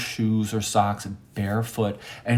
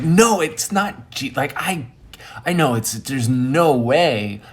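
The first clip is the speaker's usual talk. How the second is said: louder, higher self-correction; emphatic denial; 4 semitones higher, 4 dB louder than usual. Retold or imitated. Storyteller doubting he saw Jesus, emphatic denial. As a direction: talk yourself out of it forcefully. Argues with own awed thought.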